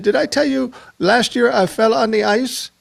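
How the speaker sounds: a fond Navajo accent impression of an old radio friend; Harry Billy's Navajo accent. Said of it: "a Navajo accent".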